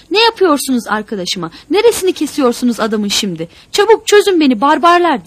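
furious bellow, rising to a high scream; very loud, outraged shouting that builds. A narrator's reading. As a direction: roar at them like a wild animal. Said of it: "diye böğürdü Tuna".

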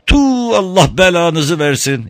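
high, loud spitting curse; angry curse. A narrator's reading. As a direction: storm off cursing them. Woman storms off cursing.

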